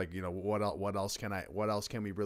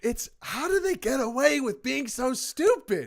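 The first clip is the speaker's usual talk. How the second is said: much higher, slow, put-on exasperated voice; outraged disbelief; 13 semitones higher, 5 dB louder than usual. Real, not acted. Co-host vents about politicians, high exasperated disbelief. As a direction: stretch out the outrage.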